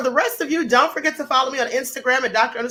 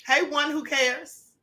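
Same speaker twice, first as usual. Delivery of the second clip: ordinary talk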